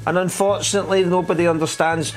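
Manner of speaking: exaggerated presenter voice. Shown as a demonstration. Edinburgh host mocking how to talk so machines understand, exaggerated presenter voice. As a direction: present it in a hammed-up, over-clear high voice. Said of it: "Let's all start talking like this".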